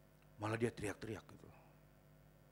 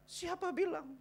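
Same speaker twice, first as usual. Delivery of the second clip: very high voice at normal volume; drawn-up high questioning retort; high-pitched retort; 3 dB louder, slower than usual. Acted. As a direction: repeat the challenge high, without shouting. Same retort repeated without the shout.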